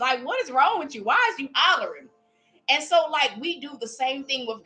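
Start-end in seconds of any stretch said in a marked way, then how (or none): 0.0-2.1 high incredulous dog voice; the dog asking if you're crazy, higher; voicing the dog. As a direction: give the dog a baffled high voice. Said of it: "Bo be looking at me like".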